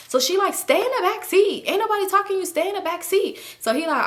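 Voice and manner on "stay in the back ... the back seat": high-pitched, sassy mock order; bossy, high-voiced telling-off; imitated snappy comeback. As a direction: put her in her place, imitating. Imitates friend snapping back.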